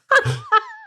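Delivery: a sudden high squeal of a laugh. Real, not acted. Friend laughing at her own joke, squealed high.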